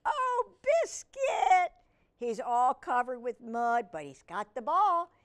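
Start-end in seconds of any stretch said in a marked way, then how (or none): 0.1-1.7 high, loud wail of mock dismay; exasperated cry. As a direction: wail the puppy's name. Dismay at muddy puppy.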